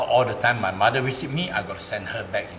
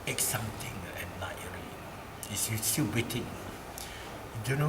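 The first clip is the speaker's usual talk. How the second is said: hushed suspense; 9 dB quieter than usual. Acted. Storyteller drops to hushed suspense.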